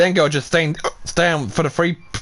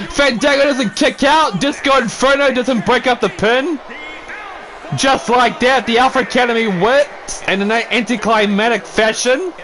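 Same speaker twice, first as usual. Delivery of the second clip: hyped wrestling commentary, higher and pushing; play-by-play call; 6 semitones higher than usual. Acted. Commentator urging Disco Inferno to fight back, excited play-by-play. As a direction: cheer the wrestlers on like a ringside announcer. Calls the match ending.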